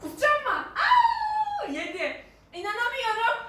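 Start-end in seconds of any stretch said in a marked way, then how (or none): 0.8-1.7 sharp high yelp of disgust; pained cry. Real, not acted. Reacts to tasting egg.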